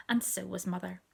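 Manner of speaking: usual speaking voice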